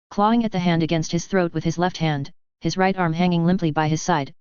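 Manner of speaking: ordinary delivery, nothing marked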